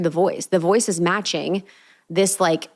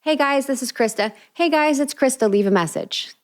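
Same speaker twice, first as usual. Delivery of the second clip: much higher, perky voicemail greeting; bright voicemail voice; 7 semitones higher than usual. Shown as a demonstration. Host demoing her voicemail greeting, high and sweet. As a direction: go up to the top, sweet and pleasant. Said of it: "That was a different tone".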